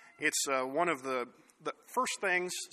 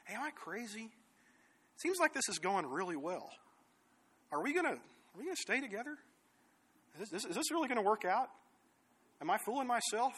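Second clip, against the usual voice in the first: put-on higher, soft voice of a teenage girl; Celine; 5 semitones higher, 4 dB quieter than usual. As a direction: softly wonder aloud in a higher, girlish voice. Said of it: "seventeen-year-old girl to her boyfriend says".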